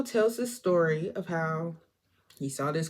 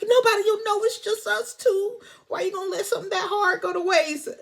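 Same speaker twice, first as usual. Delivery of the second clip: much higher, pleading whine with a fake sob; high crying plea with a faster finish; whiny seduction; 13 semitones higher, 3 dB louder than usual. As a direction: beg him like a needy temptress, hammed up.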